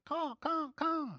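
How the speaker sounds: high mock cawing; imitated crow caw. Preacher imitating crows cawing at him, high mock caws. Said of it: "caw at you".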